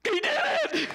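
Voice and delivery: high, ecstatic cry with laughter; joyful high yell of victory; cheering shout. Retold or imitated. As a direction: shriek it like a cheering crowd. Crowd cheering David's victory.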